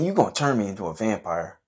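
ordinary delivery

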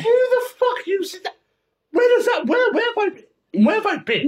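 real disbelief at the slang; shocked disbelief. Real, not acted. Stunned by unknown slang.

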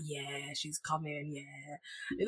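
very low, hushed voice; imagined inner thought. Retold or imitated. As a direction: murmur it low, as his private thought. Voices his private smug thought.

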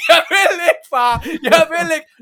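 high, loud mock whine of a scared kid; boy whining no at his father; whiny refusing boy. Boy refusing to chop hen.

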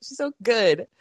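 ordinary talk

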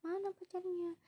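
high but soft echo of grandma; fussing grandmother. Retold or imitated. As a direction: repeat grandma's demand softly, high-pitched. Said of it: "yang nenek aku ini yang heboh gitu".

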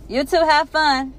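high, loud call from upstairs. Acted. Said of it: "yells back from her office upstairs".

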